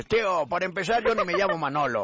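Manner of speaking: muy enojado. Acted. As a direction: turn round and protest in mock anger. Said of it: "muy enojado se voltea y dice". (enojo actuado de gallego)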